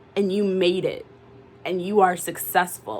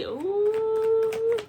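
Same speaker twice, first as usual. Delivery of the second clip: very high, drawn-out gossipy ooh; high, sing-song ooh of mock shock; scandalized ooh. Real, not acted. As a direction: tease like you've heard juicy gossip. Teasing, scandalized reaction.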